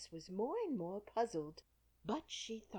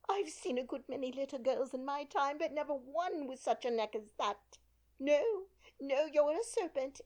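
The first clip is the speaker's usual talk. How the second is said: fretful pigeon; 7 semitones higher, 5 dB louder than usual. A narrator's reading. Pigeon character voice.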